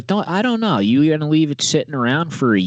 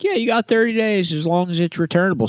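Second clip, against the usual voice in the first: lightly voiced higher-pitched clerk; easygoing yes in a raised voice; agreeable store clerk; 8 semitones higher than usual. Store clerk agreeing to take back a return, lightly voiced.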